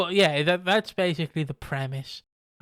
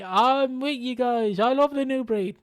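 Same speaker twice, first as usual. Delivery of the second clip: mock sincere CM Punk; 9 semitones higher, 5 dB louder than usual. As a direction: play it sweetly and pretend to be loyal. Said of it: "He did the".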